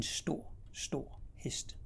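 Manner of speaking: breathy whisper of a mysterious thing; hushed retelling in a whisper. Retold or imitated. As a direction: whisper it like a secret. Boy's words retold in hushed awe.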